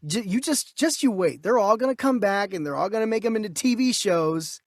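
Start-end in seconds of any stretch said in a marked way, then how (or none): none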